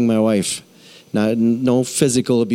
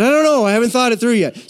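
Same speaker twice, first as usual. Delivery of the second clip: flustered blurt; 10 semitones higher than usual. Retold or imitated. Young pastor blurting to his mentor, flustered and higher. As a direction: blurt it out to the calm pastor, a little panicked.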